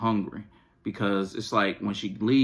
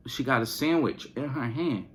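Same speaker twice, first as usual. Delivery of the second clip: normal talking voice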